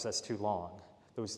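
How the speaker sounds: ordinary talk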